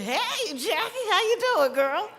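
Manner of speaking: excited greeting. Real, not acted. Greeting sister across the room.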